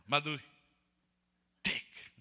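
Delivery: loud proud call to mother; triumphant call. Real, not acted. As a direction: show off loudly to her. Showing bones to mother triumphantly.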